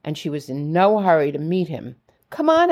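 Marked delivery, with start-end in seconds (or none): 2.3-2.7 put-on eager child yelling come on; yelled. A narrator's reading. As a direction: yell it, tugging her along. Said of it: "I yelled".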